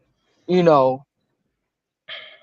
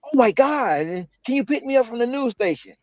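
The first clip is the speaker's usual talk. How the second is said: reporter on the phone; 8 semitones higher than usual. Retold or imitated. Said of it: "and it's".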